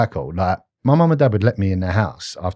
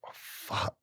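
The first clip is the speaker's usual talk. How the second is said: whispered; 19 dB quieter than usual. Retold or imitated. Retold moment at the safe.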